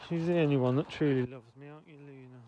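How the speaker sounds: loud, doting praise of the dog; gushing over a beloved pet. Real, not acted. Owner doting loudly on her dog Luna.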